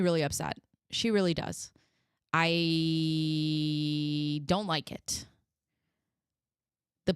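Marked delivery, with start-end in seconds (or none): 2.3-5.3 "I" held very long on one level note; drawn-out reluctance. Real, not acted. Host admitting dislike of the female officer, drawn-out reluctance. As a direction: stretch "I" right out before finishing the sentence.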